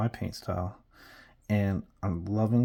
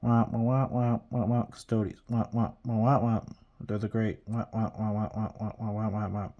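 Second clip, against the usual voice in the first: wah-wah muffled grown-up babble; mocking wordless wah-wah chatter; Charlie Brown. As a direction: mimic a droning cartoon teacher. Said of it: "all I think about is, uh, Charlie Brown".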